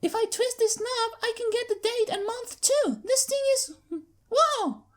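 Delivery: high, breathless thrilled filly; excited filly. Acted. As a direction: rush it out then stumble with a whoa. Filly excited, then trips.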